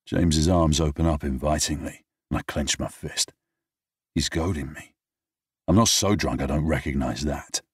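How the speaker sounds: usual speaking voice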